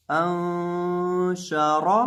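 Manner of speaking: अंशा chanted very slowly with a nasal hum on the noon; drawn-out recitation. Shown as a demonstration. Teacher reciting the joined syllables for students, slow chanted ghunna. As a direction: hold the noon of अंशा in a long sung ghunna.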